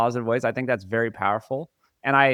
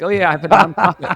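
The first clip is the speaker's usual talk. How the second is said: breezy, louder put-on voice; casually bragging in a silly voice; offhand mock voice; 5 semitones higher, 9 dB louder, faster than usual. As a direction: toss it off like it's nothing. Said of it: "It's like".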